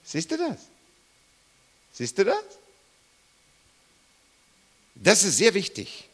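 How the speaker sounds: normal talking voice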